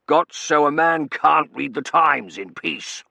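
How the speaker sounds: loud, slow, indignant colonel; irate gentleman disturbed at his newspaper; blustering colonel. A narrator's reading. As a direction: complain loudly, drawing the words out. Disturbed colonel grumbles loudly.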